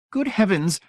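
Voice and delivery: high, fluttery society lady; fussy complaint. A narrator's reading. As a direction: sigh it out like a prim matron. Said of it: "she complained".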